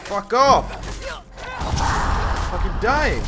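high, loud screamed curse; furious shout. Real, not acted. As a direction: scream it while dying in the fight. Fighting, about to die.

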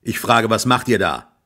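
loud, slow, rough call from below; gruff farmer calling out; coarse farmer's shout. Acted. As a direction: bellow up at the boy in the tree. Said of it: "rief eine grobe Stimme".

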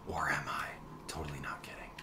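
a conspiratorial whisper to chat; teasing whisper. Pretend. Whispering a tease to the viewers. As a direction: whisper it, breathless and sly.

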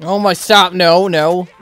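ordinary talk

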